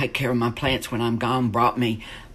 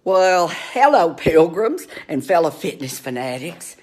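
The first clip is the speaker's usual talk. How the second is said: fitness host greeting; 5 semitones higher, 6 dB louder than usual. Pretend. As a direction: open like a campy workout-show host. Mock fitness show opening.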